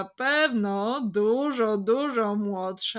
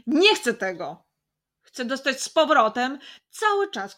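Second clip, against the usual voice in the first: loud, insistent, higher voice; forceful refusal; 4 semitones higher, 7 dB louder than usual. Acted. Boy rejecting his phone, loud insistent refusal. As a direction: push the words out with force. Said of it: "powiedział z mocą".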